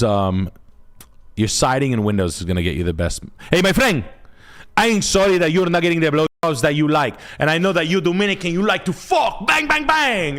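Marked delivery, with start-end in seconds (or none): 3.5-10.4 high, excited street-guy voice; high and worked up, hamming it; mock Dominican guy. Mocking the viewer's Dominican friend, high and excited.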